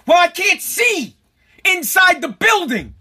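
high, slow, emphatic yell; exasperated shouting back. Retold or imitated. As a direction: argue back loudly, dragging out each phrase. Acted yelling match at post office.

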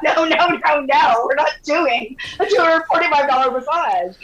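high, emphatic string of retold no's; mock-outraged protest; indignant refusal.

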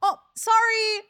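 high, slow embarrassed "oh sorry"; sheepish apology. Shown as a demonstration. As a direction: apologise awkwardly through the door. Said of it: "That's what I normally say".

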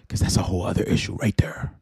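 a quick whispered joke; conspiratorial whisper to the audience; whispered aside. Real, not acted. Joking aside to viewers.

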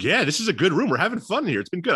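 high-pitched, pleasantly surprised quote; a little surprised. Retold or imitated. Star players on their new locker room, mildly surprised. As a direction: imitate players surprised at their own fun. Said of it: "almost sound a little surprised".

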